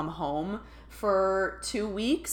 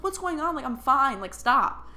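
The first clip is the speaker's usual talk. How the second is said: higher, louder mock bewilderment; retold exasperated protest; 9 semitones higher, 5 dB louder than usual. Telling own body to stop crying, exasperated. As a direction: protest at your own tears.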